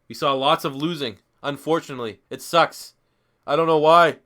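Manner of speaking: ordinary talk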